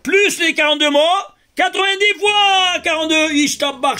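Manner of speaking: excited. Real, not acted. Teacher reveals the big result.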